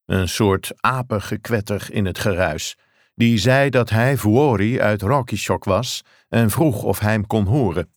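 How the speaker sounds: ordinary delivery, nothing marked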